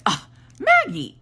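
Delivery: high groan into the name; exasperated. Retold or imitated. Son to sister who insulted his eyes, exasperated. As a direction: imitate an annoyed brother.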